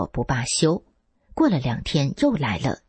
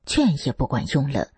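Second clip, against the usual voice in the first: plain reading voice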